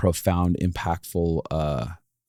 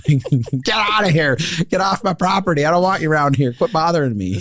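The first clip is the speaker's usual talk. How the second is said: angry yelling; 8 semitones higher than usual. Acted. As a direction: shout them off your property. Voicing people throwing trash out.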